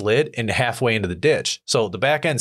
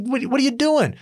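baffled. Retold or imitated. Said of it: "I'm like".

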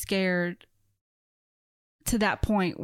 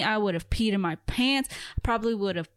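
ordinary talk, nothing marked

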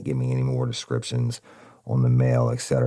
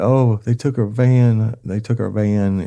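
high, loud wail, drawn out; imitating a crying wife, louder and higher; mock crying; 8 semitones higher, 8 dB louder than usual. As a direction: retell it as a hammed-up tearful wail. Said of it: "and she started crying".

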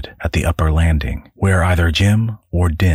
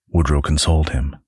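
normal talking voice

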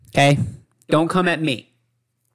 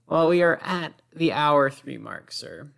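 ordinary talk, nothing marked

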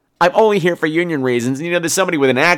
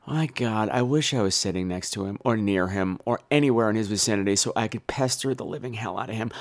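much softer starstruck musing; lowered, soft awe at seeing Kevin Bacon; inner thought; 7 semitones lower, 10 dB quieter than usual. Retold or imitated. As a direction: muse to yourself in awe. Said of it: "I thought".

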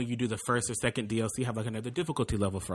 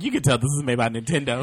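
laughing; 4 semitones higher, 8 dB louder than usual. Retold or imitated. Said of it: "I was like".

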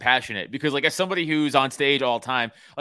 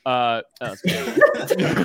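laughing it off; 6 dB louder, much slower than usual. Real, not acted. Laughing after the punchline.

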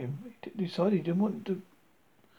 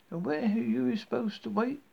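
sharp scolding; 6 semitones higher than usual. Acted. Angry babysitter scolds.

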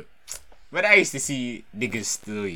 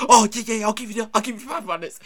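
higher, louder flustered stammer; mock flustered cashier caught out; 10 semitones higher, 6 dB louder than usual. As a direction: blurt it out all flustered. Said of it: "you just bare flustered".

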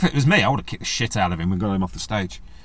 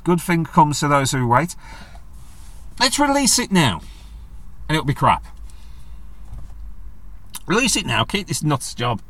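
mock exasperation; 4 semitones higher than usual. Voicing own inner rant.

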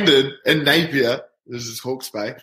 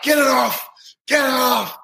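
retold panicked yell; 10 semitones higher than usual. Teammates shout to get the shield off, high frantic yell. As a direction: yell it like the trophy is falling apart. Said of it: "And they're like".

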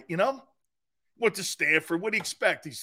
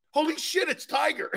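stunned blurt; 8 semitones higher than usual. Retold or imitated. Showing Tone how he sounded on seeing Tiger, stunned high blurt. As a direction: re-enact a dumbfounded outburst up high. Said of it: "you should have heard me".

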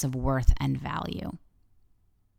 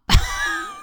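sudden high laugh; 4 dB louder than usual. Real, not acted. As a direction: burst into a high embarrassed laugh. Embarrassed laugh at own story.

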